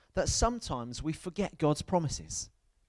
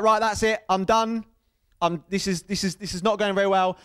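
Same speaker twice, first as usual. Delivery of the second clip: higher, louder and fast, mock panic; 4 semitones higher, 4 dB louder than usual. Child at the 3D film giving up, higher, louder mock panic. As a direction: rattle it off like a scared kid.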